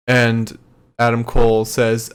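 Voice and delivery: ordinary talk, nothing marked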